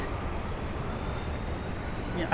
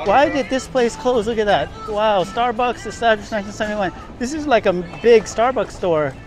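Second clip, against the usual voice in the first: high, louder burst of surprise; surprised; 7 semitones higher, 5 dB louder than usual. Real, not acted. Vlogger spotting a closed Starbucks, surprised exclamation. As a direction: exclaim at an unexpected sight.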